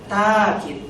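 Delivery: "takid" with a drawn-out first a; long-vowel reading. Shown as a demonstration. Teacher listing three valid readings, "takid" with long a. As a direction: say "takid" as taa-kid, no stop in the middle. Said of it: "boleh dibaca takid".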